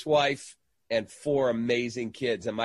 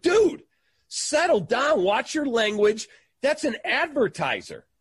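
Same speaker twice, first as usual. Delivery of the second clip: much higher, louder rebuke; shocked scolding; 10 semitones higher, 4 dB louder than usual. Retold or imitated. To his swearing friend in the skybox, a high loud scolding. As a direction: yelp at a friend to calm down.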